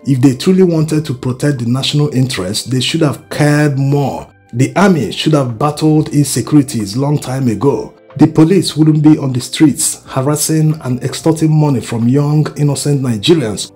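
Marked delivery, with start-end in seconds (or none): none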